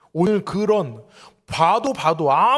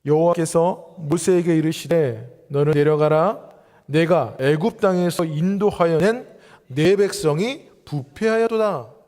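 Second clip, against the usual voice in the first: slow, louder solemn Bible reading; scripture reading; 5 dB louder, slower than usual. Shown as a demonstration. Pastor reads the verse aloud to the church, slow and solemn. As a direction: read God's words slowly and weightily. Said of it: "칠 절 말씀을 읽겠습니다".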